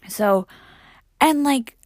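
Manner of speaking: normal talking voice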